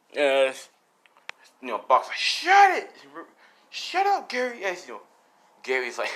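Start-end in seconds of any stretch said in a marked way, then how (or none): 2.2-4.6 high, loud acted yell; pitched-up exasperated outburst; angry shout. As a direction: shout down a friend who is laughing. Bak yells at laughing Gary.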